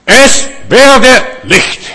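booming divine command. Acted. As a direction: proclaim it like God speaking light into being. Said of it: "So kurz".

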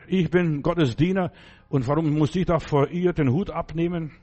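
usual speaking voice

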